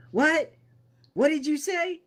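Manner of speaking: very high-pitched yell; yelling back. Acted. Player yelling back at Guy, high-pitched yell. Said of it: "I start yelling back at".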